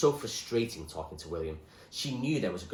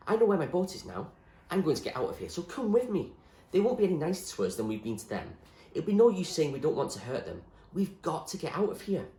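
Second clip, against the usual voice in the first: put-on higher, urgent girl's voice; Zoe pleading; 8 semitones higher, 4 dB louder than usual. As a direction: urge him to run with her. Zoe urges William to flee.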